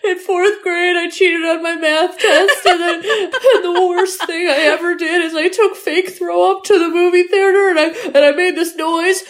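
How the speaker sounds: high, rushed, laughing confession; frantic confession. Retold or imitated. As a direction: blurt out secrets half laughing. Voicing Chunk's frantic confession.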